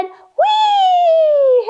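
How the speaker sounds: high loud squeal of wee; tiny mouse shrieking wee for fun; excited wee. Pretend. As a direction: shriek it with glee like a mouse on a ride.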